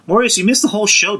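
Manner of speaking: excited call-out. Real, not acted. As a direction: call out to the late viewer, playfully loud. Teasing a late chat viewer.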